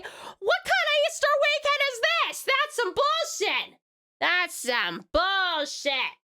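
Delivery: high-pitched indignant protest, drawn out at the end; playful, high-pitched indignation; mock outrage. Real, not acted. Indignant over a friend's work weekend.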